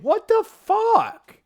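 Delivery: high, slow, drawn-out What the fuck; incredulous swear. Real, not acted. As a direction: let the full curse out in disbelief. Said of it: "I'm gonna say it".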